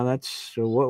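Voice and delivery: ordinary delivery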